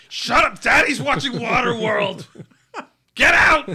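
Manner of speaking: high, loud mock shout; yelling dad. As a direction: bark at the kids to leave.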